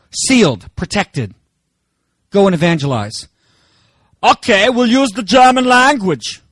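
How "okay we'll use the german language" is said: light put-on German accent, "German" said as "Jerman"; a jokey German accent; mock German accent. Preacher voicing a German evangelist for the congregation, mock German accent. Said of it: "I'm just doing an accent thing".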